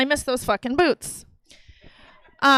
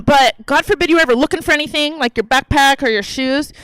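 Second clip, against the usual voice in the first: ordinary delivery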